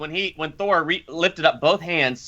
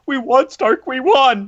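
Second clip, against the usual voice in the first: higher, louder desperate cry of a lightly voiced Peter; imitating Peter crying out; 8 semitones higher, 5 dB louder, slower than usual. Peter calling to dying Stark, high and louder. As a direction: plead with the dying mentor. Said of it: "Peter Parker's like".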